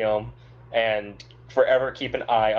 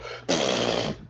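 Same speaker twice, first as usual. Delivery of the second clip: sputtering spray of a spit take; mock spit take; 12 semitones lower, 4 dB quieter than usual. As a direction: blow out a wet raspberry, as if spraying a drink. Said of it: "I'm gonna immediately just go".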